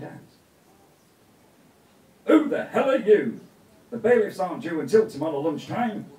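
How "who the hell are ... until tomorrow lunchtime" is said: loud, indignant challenge at the door; startled, loud demand; Charlie's outburst. A narrator's reading. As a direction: bark at the strangers. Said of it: "said Charlie".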